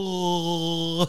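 wordless Wookiee moan; amateur Chewbacca call; Chewbacca attempt. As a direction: try a drawn-out Chewbacca groan. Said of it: "I'm trying to do a Chewbacca".

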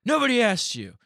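high, loud mock shout; yelled retort. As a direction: imitate a man hollering back in annoyance. Retold yelled retort from the street.

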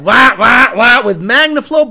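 loud wah wah of a choppy idle; engine imitation. Imitating a cammed engine for viewers, loud wah sounds. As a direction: mimic the cam chop, loud.

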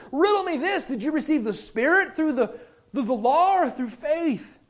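high, exasperated questioning; Paul beside himself. Acted. Paul challenging them on law or faith, acted exasperation. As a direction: demand an answer in high-pitched disbelief. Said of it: "Paul is beside himself".

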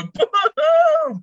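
loud high "Boom!" shouted with excitement; crowd shout. Pretend. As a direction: yell it like a hyped crowd.

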